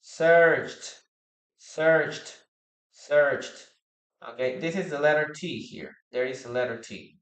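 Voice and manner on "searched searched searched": "Searched" said as one syllable, silent e, ending in t. Shown as a demonstration. Teacher drilling students on "searched", one syllable ending in t. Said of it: "we don't pronounce the letter E".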